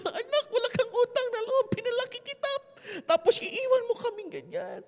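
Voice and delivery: high-pitched mock scolding from a hurt mother; nanay na nanunumbat. Imagined mother scolding her son. (mataas na boses ng inang nanunumbat sa anak)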